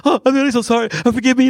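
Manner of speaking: high, loud, gushing mock apology; hammed-up flustered begging for forgiveness; apologetic Swedish person. Imagined Swedish person being apologised to, mock gushing.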